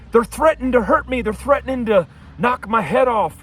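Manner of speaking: imitating a panicky caller, high-pitched; high-pitched mocking re-enactment of an accuser; mock alarmed complaint. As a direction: play up the alarm of a caller reporting threats. Imitating neighbor's alarmed phone complaint.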